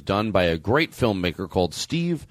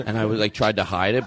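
normal talking voice

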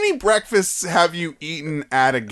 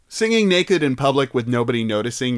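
normal talking voice

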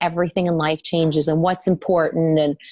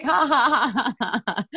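a slow, drawn-out fake ha ha; slow mocking laugh; 7 semitones higher than usual. Teasing her panicked husband with a slow fake laugh. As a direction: laugh at him slowly, teasing.

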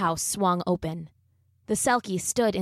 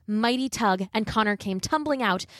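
ordinary delivery, nothing marked

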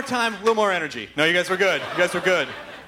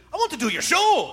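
higher-pitched mock Scottish accent; hammed-up Scottish quote; 6 semitones higher than usual. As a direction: imitate him with a put-on Scottish accent. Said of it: "after my Scottish accent".

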